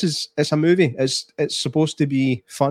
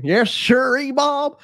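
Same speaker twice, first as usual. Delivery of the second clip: much higher, slow put-on American accent; mock American accent; 12 semitones higher, slower than usual. Calling back the joke mid-review, a higher slow mock American accent.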